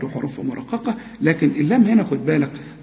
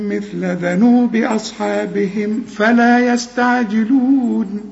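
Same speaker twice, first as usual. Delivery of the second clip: slow melodic tajweed chant; measured, sustained recitation on a level tone; Quran recitation; 3 semitones higher, much slower than usual. Acted. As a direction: chant the verses with long held vowels and hums. Recites the verses for memorising.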